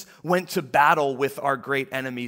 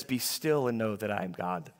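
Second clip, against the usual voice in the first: soft and cozy, a little lower; cozy, soft quote; 6 semitones lower, 5 dB quieter than usual. Retold or imitated. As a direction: say it like a cozy coffee-mug poster. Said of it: "sitting cozy under a blanket".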